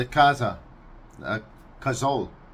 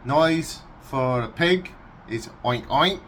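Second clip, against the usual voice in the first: normal talking voice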